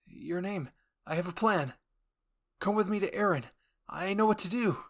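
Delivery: high, excited and determined; eager urgency. Acted. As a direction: burst out with the idea. Armin announcing a plan, excited.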